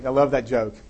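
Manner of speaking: usual speaking voice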